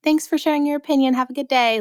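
high, sing-song polite dismissal; mock-sweet sign-off before walking away; polite brush-off. Host acting out answering a hater, politely dismissive. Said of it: "I'm more like".

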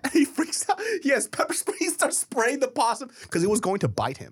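high, fast, breathless retelling; excited storytelling. Real, not acted. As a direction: pile it on with glee. Excited climax of possum story.

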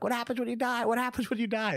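put-on high-pitched, insistent kid voice; pestering child. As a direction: repeat it like an anxious child in the backseat. Retelling himself as panicked child in backseat.

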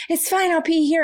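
put-on a child who sees no problem; Esme. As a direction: mum imitating her daughter, matter-of-fact. Mum voicing her daughter Esme.

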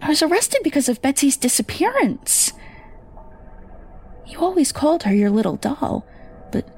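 a mother realising the worst; anguished mother. Acted. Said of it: "my mother's anguished cries".

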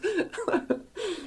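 a high, soft burst of laughter. Real, not acted. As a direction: laugh at the story. Laughs at friend's story.

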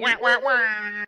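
muffled wah wah talk. Acted. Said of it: "Get off my lawn".

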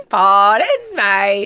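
laughing Cockney reply; Cockney accent. Pretend. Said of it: "sound like a fifty-year-old Cockney man".

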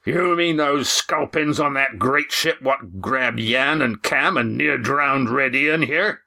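big, high, drawn-out seaman's bluster; Roaring Jack. A narrator's reading. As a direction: boom the question out slowly. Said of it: "boomed Roaring Jack".

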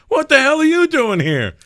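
high, loud incredulous greeting. Retold or imitated. Rock star surprised to find a friend, mock loud greeting. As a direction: imitate a friend's astonished shout. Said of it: "He goes".

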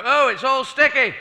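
an astronaut whining about jam on the moon, high and loud; high, loud complaining astronaut; loud whiny astronaut. Acted. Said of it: "get out there and go".

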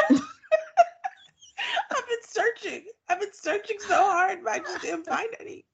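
high, whiny fake sob; mocking a crying wife. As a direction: imitate her tearful whimper through laughter. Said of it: "mocking Alyssa's crying".

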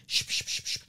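quick repeated shushes; mimed telling someone to pipe down; imitated shushing. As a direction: hush someone with short, voiceless shushes. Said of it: "he wasn't even like".

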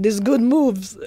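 a light dad impression, gruff; mimicking my dad saying it. Podcaster mocking himself by sounding like his dad. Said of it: "I sound like my dad".

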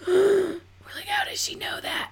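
gasp, then a breathless high whisper; stunned gasping whisper. Retold or imitated. Hushed gasp to her sister Lucy at the psychic's news. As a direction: whisper it high, as if you can't believe she knew. Retold shock at the reading.

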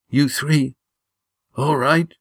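high, strained wounded voice; weak dying man. A narrator's reading. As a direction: push out each word through pain. Dying Gar speaks.

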